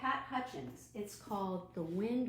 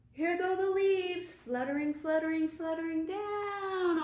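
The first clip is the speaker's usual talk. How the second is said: high, loud fluttering call; excited; 9 semitones higher, 6 dB louder than usual. Acted. Librarian plays the wind with the children, excited. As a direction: whip the kids up like the wind.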